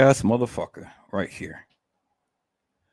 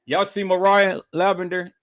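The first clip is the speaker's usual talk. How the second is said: ordinary delivery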